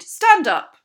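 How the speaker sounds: very loud, high command; full-force parade-ground shout; shouted order. A narrator's reading. Captain ordering a boy to his feet with a furious shout. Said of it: "he shouted".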